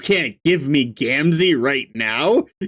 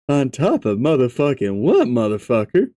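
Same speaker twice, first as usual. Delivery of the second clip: Gamzee voice; 5 semitones lower than usual. Acted. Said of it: "Gamzee's voice".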